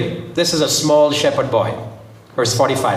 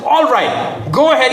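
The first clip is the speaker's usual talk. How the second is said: finally consenting; 9 semitones higher, slower than usual. A narrator's reading. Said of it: "Saul consented, finally consented".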